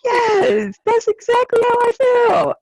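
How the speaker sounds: overjoyed high-pitched agreement; excited squeal. Retold or imitated. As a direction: squeal it like you've found your kindred spirit. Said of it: "And I'm like".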